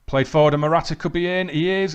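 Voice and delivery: rising alarm as he's in on goal; excited call. Real, not acted. Striker through on goal.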